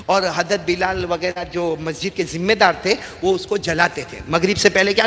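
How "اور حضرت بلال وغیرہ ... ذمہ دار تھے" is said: ordinary talk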